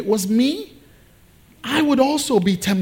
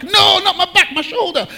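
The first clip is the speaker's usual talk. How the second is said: much higher, correcting wife voice; impatient wife; 10 semitones higher than usual. Retold or imitated. Wife in labour pains correcting clueless husband, high and impatient. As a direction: play the wife snapping back a correction.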